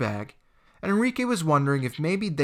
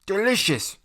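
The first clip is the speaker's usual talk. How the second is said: through a mouthful; 6 semitones higher, 4 dB louder than usual. Acted. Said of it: "through a mouthful of tuna".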